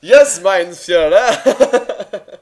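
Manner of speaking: loud, high, drawn-out mock German; over-the-top German accent. Mocking German accents in war films, hammed-up German. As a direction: ham up a German accent, laughing. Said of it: "really over the top".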